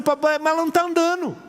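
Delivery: father pleading with the doctor; desperate protest. Retold or imitated. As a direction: protest it in a high, anxious voice.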